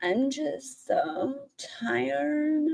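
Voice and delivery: very slow, soft, whiny mock tiredness; imitating her tired voice. Host mocks the streamer's put-on tired voice, very slowly. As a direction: drag it out mocking her tired whine. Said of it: "her tired voice ... so put on".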